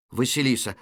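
normal talking voice

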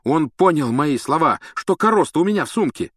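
insistent anger. A narrator's reading. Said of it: "не унимался Рон".

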